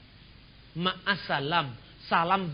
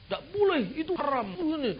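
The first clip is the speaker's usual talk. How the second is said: higher, mocking voice of a strict cleric; mock strict preacher; 8 semitones higher than usual. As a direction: play the stern forbidding preacher, hammed up.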